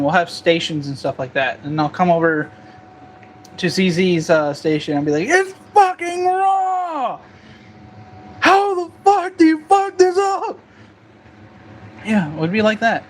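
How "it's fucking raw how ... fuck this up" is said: very high, slow hammed-up chef yell; mock Gordon Ramsay rant. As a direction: play the raging TV chef at a cook. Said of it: "we'll be like Hell's Kitchen".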